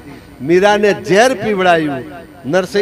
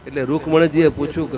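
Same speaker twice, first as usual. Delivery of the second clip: normal talking voice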